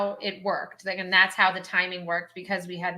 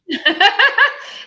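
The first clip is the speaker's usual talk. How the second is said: loud laugh; 12 semitones higher, 8 dB louder than usual. Real, not acted. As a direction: burst of high laughter. Laughing at the photo names.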